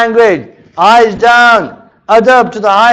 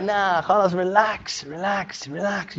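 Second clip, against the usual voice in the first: softer and softer, soothing yourself; soft relax; 11 dB quieter, slower than usual. Shown as a demonstration. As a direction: talk yourself down gently. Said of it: "demonstrating calming himself".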